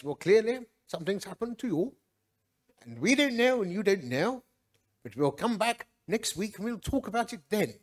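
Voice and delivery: lightly put-on voice of an elder; a hint of mimicry, quoting the church elder; Ron Blows. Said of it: "Ron Blows talk like this".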